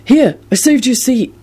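high, eager offer; hastily inviting; quick offer. A narrator's reading. Neville offering late Luna a seat, quick. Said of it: "Neville told her quickly".

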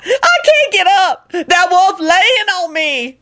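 high, drawn-out wail; slow, helpless wail up high; wailing Lucretia voice. A narrator's reading. Lucretia wailing under the wolf.

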